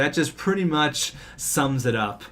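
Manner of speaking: usual speaking voice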